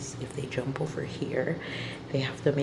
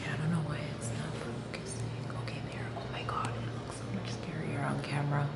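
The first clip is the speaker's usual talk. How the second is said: breathy whisper, softer than usual; 9 dB quieter than usual. Real, not acted. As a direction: whisper it, nervous and hushed. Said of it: "I have to whisper this".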